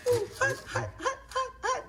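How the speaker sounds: high, laughing mock yelp of heat; laughing hot hot. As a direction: tease him with a laughing hot hot. Teasing him about hot ribs.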